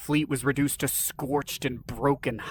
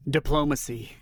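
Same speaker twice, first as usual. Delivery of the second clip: ordinary delivery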